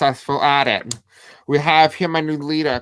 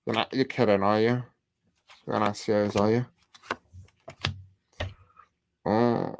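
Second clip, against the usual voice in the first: hushed disbelief; 5 semitones lower, 8 dB quieter than usual. Real, not acted. Reacts in disbelief to a card.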